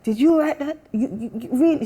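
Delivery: mock her mum's amazement in a Jamaican accent. Daughter imitates her surprised mum in a Jamaican accent. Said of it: "I keep doing the accent".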